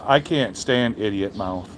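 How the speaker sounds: usual speaking voice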